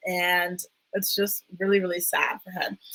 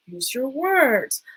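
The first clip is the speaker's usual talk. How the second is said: slow, higher-pitched parental coaxing; parent to child; 5 semitones higher, much slower than usual. Retold or imitated. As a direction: imitate coaxing a small child. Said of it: "like a parent speaks to her child".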